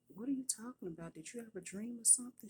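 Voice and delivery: incredulous mock questioning; retold bafflement. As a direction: act out a baffled mother quizzing her child. Mother retells her baffled questioning.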